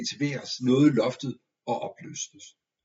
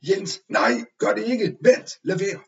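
high, loud desperate shouts to stop the rooster; alarmed outcry; 8 semitones higher, 6 dB louder, slower than usual. A narrator's reading. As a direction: cry out in alarm, no, don't do it. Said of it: "Vi for begge op med et udbrud".

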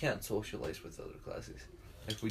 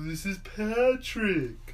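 slow, high, dopey Patrick voice; Patrick impression; 8 semitones higher, 4 dB louder, much slower than usual. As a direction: sound slow-witted and proud of it. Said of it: "I can do a decent Patrick".

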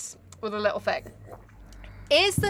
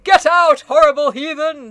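louder, hammed-up snap; mock angry dismissal; 4 semitones higher, 7 dB louder than usual. Narrator ordering the Raven out, in a loud mock rage. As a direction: shoo the bird away theatrically.

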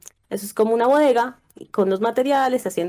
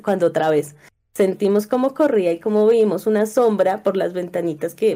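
ordinary talk

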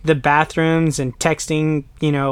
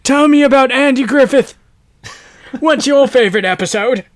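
put-on loud, high old British man voice; 9 semitones higher, 6 dB louder than usual. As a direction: slip into a hammed-up elderly British accent. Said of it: "to old British man".

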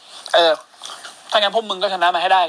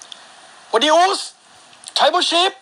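drawn-out, high show host welcome; slow, lifted podcast opener; showman greeting; 9 semitones higher, slower than usual. Acted. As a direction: stretch the hello out slow like opening a show. Show opening greeting to listeners.

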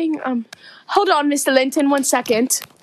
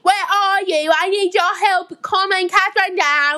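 higher, pleading Yorkshire servant; thick Yorkshire accent; 5 semitones higher than usual. Acted. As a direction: beg for help in Yorkshire. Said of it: "That is one thick Yorkshire accent".

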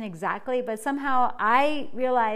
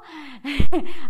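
burst of laughter; 3 semitones higher, 11 dB louder than usual. Real, not acted. Laughs about loving vegans.

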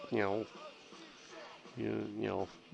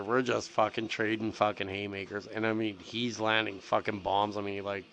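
normal talking voice

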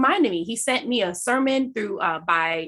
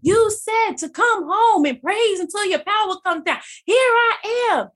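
crying out to God; 10 semitones higher, 3 dB louder than usual. Retold or imitated. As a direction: lift it up as an offering, voice rising.

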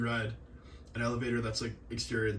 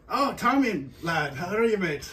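high, loud off-the-cuff Scottish greeting; Scottish accent; 7 semitones higher, 5 dB louder than usual. Retold or imitated. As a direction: imitate a Scottish teacher calling out a hello. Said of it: "off-the-cuff Scottish".

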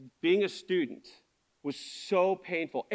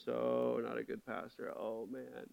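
very low, quiet dejection; acted-out discouraged self-talk, hushed and low; feeling inadequate; 8 semitones lower, 11 dB quieter, slower than usual. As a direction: sink into a deflated mumble to yourself. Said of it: "feel really quick inadequate".

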